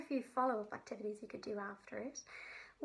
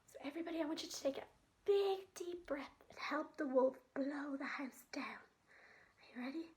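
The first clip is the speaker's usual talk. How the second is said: gentle, expressive storytelling for little ones; sensory story read aloud for kids; reading to children. Acted. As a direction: tell it like a bedtime story to a child.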